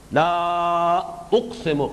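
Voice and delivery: لا held very long on a level note, then اقسم; recitation-style elongated لا; long-drawn لا. Shown as a demonstration. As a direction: stretch the vowel of لا before اقسم. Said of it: "اس لا کو کھینچنا چاہیے".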